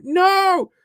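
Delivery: shout. Real, not acted. High shout of refusal.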